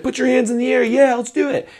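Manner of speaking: loud, high rally call; commanding. Shown as a demonstration. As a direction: fire up the crowd. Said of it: "Be like".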